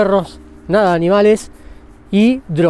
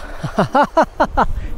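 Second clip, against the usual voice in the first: shocked laugh from cold; 5 semitones higher, 4 dB quieter than usual. Real, not acted. Steps into icy river.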